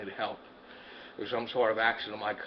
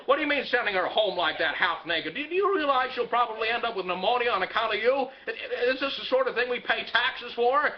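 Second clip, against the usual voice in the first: hammed-up furious telling-off, pitched higher; angry rant; 8 semitones higher than usual. George Bailey rants at his child's teacher on the phone, angry.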